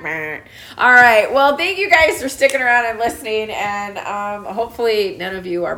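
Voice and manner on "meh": low, soft imitated bleat; playful low "meh" bleat; sheep bleat. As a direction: answer with a lower sheep noise.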